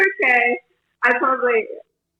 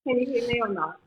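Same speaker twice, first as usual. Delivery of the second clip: ordinary talk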